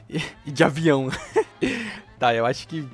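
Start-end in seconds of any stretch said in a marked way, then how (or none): 0.0-1.2 laughing. Real, not acted. Laughing at odd game concept.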